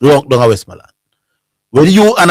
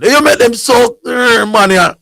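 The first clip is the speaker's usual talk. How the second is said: mock taunt; 10 semitones higher, slower than usual. Russia man taunting continues.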